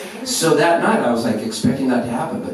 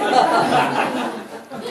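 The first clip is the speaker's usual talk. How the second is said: laughing; 9 semitones higher than usual. Real, not acted. Laughing during retold dialogue.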